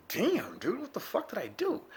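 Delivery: high, dismayed question; retold dismay. Sore man asking himself, high and dismayed. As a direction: wince and ask in disbelief. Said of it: "I'm like".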